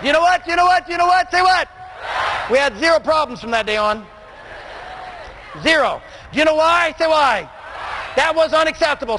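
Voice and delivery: revival-style call and response; fired-up preaching. Acted. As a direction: whip the room up and demand an answer.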